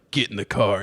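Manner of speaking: low TV tough guy; low-voiced imitation of a man's order; retold gruff order. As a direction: drop the pitch for a terse command.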